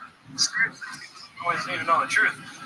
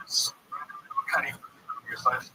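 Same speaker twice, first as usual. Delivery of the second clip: ordinary talk, nothing marked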